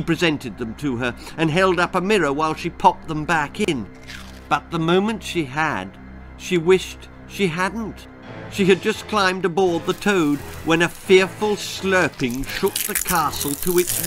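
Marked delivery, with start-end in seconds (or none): none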